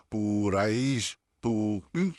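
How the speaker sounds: पुरैश with the first vowel dragged out slowly; पुरैश, पू held far too long; overlong vowel. Shown as a demonstration. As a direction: show the wrong, stretched पू. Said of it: "कितना खींचा है मैंने".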